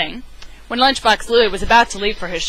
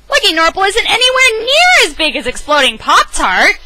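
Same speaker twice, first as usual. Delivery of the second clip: loud denial; 11 semitones higher, 6 dB louder than usual. A narrator's reading. King Waffle flatly denying.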